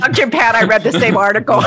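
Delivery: laughing through the words. Real, not acted. To Doctor Pat, laughing at the pizza story. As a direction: giggle as you confess it.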